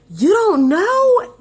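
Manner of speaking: high, loud, slow incredulous grandma; grandma aghast on the phone, high and loud; shocked disbelief. Retold or imitated. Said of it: "she's like".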